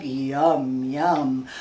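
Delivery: low frog voice; low frog yum. Pretend. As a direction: rumble it like a frog. Frog eating bug.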